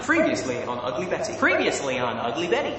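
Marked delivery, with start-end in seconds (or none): 1.4-2.8 American TV recap accent. Shown as a demonstration. Brit doing show recap line, American accent. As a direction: announce it as American TV. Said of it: "my best American accent".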